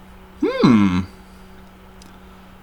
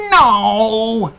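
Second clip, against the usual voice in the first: shouted no; 3 semitones higher than usual. Retold or imitated. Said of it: "I love his reaction when".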